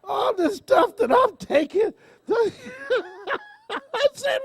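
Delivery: high, slow, laughing it out; can barely get it out for laughing; laugh-cry in disbelief. Real, not acted. Laughing at her pile of pills.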